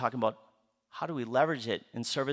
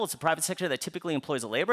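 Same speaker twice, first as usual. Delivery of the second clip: usual speaking voice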